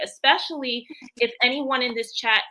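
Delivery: ordinary talk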